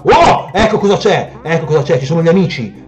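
loud yelp, then high excited cries; high, worked-up reaction; startled exclamation. Real, not acted. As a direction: exclaim at a nasty surprise. Startled by more enemies.

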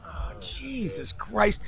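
slow, drawn-out whispered groan; exasperated whisper. Retold or imitated. Hearing the booking agent called, whispering in dread. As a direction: breathe it out in dread. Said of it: "And I was like".

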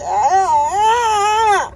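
loud frustrated wail. Real, not acted. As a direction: let out an upset cry. Frustrated cry.

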